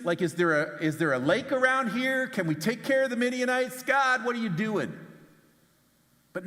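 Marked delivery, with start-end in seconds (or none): 0.0-5.0 higher, louder, incredulous; exasperated plea. Acted. Gideon mocking his plight, pleading with God, exasperated. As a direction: demand an answer from God.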